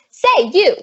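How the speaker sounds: loud, sharp hail to a stranger; calling out. Acted. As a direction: demand her attention across the room. Confronting woman across the room.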